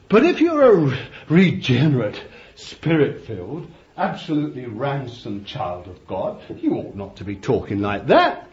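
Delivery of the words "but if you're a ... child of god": preaching at full force. Acted. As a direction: pile up the sins from the pulpit. Preacher builds to climax.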